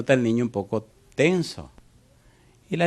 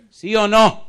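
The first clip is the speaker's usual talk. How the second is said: high, loud repeated question; shouted challenge to the flock; 10 semitones higher, 7 dB louder, slower than usual. Real, not acted. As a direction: demand an answer from the crowd. Preacher presses the congregation.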